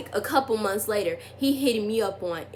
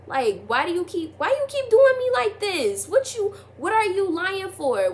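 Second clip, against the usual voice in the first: exasperated outburst; 7 semitones higher than usual. Retold or imitated. Demanding the boy explain his lying, high and exasperated. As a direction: let the frustration peak near a cry. Confronting him in retold texts.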